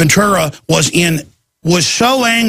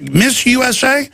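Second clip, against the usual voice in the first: mock surprise; 7 semitones higher than usual. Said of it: "They're like".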